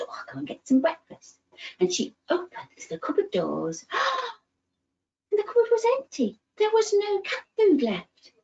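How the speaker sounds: lively, animated storybook voice; reading to children. Acted. Storyteller reading George's adventure to little ones, animated. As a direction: tell it like a bedtime tale full of wonder.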